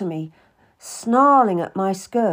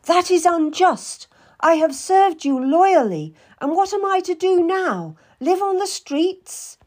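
much higher, loud indignant protest; a loyal servant bursting out at injustice; rising anger; 10 semitones higher, 5 dB louder than usual. Acted. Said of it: "I felt anger rise within me".

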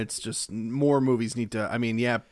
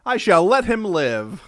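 mock grand; 6 semitones higher, 6 dB louder, slower than usual. Mock-grand mercy for barking dog.

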